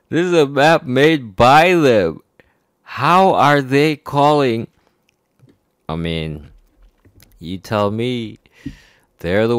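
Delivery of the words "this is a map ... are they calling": raised, higher, exasperated voice; real indignation. Real, not acted. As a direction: hammer it out, indignant and loud. Worked-up rant about the map.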